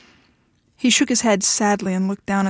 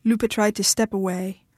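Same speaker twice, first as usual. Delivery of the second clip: ordinary delivery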